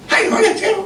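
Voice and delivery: high-pitched mock call; imitated foreign shout. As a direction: mimic someone calling out in a high squeal. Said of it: "tiba-tiba dia teriak gini".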